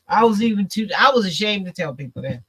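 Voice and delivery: usual speaking voice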